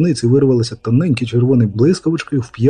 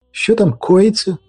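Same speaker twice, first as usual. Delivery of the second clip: drawn-out, high-pitched query; wondering what's going on; puzzled question; 7 semitones higher, slower than usual. Acted. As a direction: ask slowly in a raised voice. Onlooker asks about the commotion.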